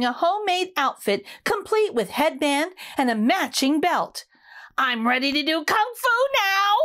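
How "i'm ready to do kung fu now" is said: proud declaration. Acted. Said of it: "declared Rudy".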